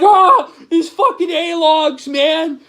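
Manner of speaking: high, slow, whining parody; mocking whiny voice. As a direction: ham up a sulking moderator complaining. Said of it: "and you're like".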